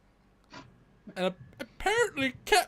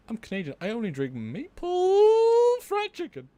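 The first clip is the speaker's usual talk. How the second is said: Canadian voice; 5 semitones higher, 5 dB louder than usual. Acted. Canadian persona boasting.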